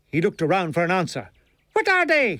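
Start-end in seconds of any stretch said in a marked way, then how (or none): 1.7-2.4 high, irritated Irish character voice; angry. A narrator's reading. Milligan repeats his question about his legs, angrily. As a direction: demand it crossly, pitched well up. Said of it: "he repeated angrily".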